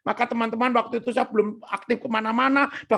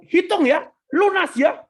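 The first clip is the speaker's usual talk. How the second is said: higher, louder triumphant command; 7 semitones higher, 4 dB louder than usual. Retold or imitated. Son throws money before creditor, triumphant. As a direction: toss it out as the winner, re-enacted. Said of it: "saya merasa menang".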